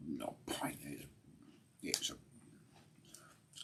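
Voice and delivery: a secret little whispered correction; whispered aside. Real, not acted. Whispering not a pint to viewers on his stream. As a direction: whisper it, nearly silent. Repeats joke, dropping voice to whisper.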